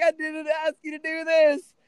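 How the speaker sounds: retold exasperated pushback; incredulous. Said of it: "I'm like".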